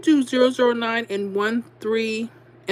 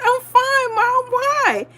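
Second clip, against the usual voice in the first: very high, loud re-enacted squeal; caught-out reply; 4 dB louder, much slower than usual. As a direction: answer high-pitched, as if caught out. Caught out, replying to mother-in-law.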